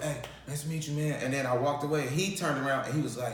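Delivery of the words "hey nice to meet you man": quiet, starstruck hello; soft nervous hello; shy greeting. Retold or imitated. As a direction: say it softly, a bit awed.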